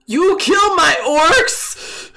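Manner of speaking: slow, loud outraged giant shout; angry giant bellow. Acted. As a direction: roar it like an enraged dumb giant.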